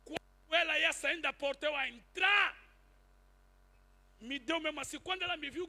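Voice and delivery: ordinary talk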